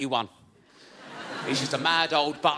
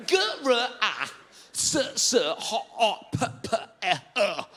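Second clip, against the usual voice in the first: glitching Zoom voice; 5 semitones higher than usual. Retold or imitated. Assessor on a buffering Zoom call, letters stretched. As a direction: spell it like a frozen video call.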